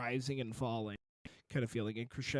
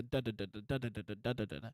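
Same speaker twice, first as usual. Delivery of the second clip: rapid-fire da-da-da imitating a drone; imitated drone; 3 semitones lower, 4 dB quieter, much faster than usual. Shown as a demonstration. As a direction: rattle off the background drone in quick syllables. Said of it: "drone that you hear where it's like".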